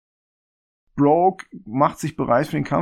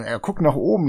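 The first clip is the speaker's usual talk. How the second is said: normal talking voice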